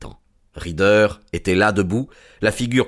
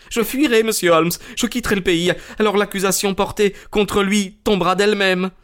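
high-pitched, frightened bargaining; acted cornered culprit; desperate pleading; 11 semitones higher than usual.

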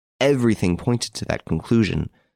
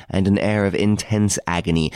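ordinary delivery

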